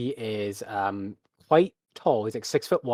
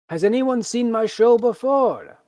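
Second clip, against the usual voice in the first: high, loud, slow ringmaster call; showman calling to crowd; 12 semitones higher, 7 dB louder, slower than usual. Acted. Tamer calling to the gathered crowd, loud showman call. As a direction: call out over the whole crowd.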